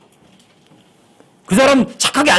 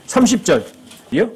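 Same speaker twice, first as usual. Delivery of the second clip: usual speaking voice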